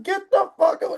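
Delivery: high-pitched indignant outburst; acted, high-pitched annoyance; indignant brush-off. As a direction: push them away with a high, sharp retort. Acted brush-off of someone greeting him.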